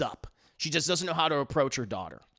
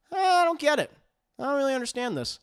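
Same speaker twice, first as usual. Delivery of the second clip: much higher, faster puzzled alien voice; 10 semitones higher, 4 dB louder, faster than usual. Retold or imitated. Alien creature puzzling over the grieving girl, high-pitched.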